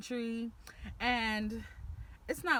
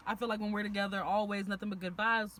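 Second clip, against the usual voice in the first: usual speaking voice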